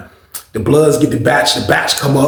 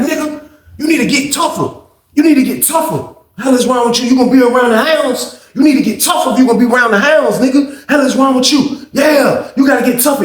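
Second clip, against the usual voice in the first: mock berating; 9 semitones higher than usual. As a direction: chew him out, mocking. Bully berating victim.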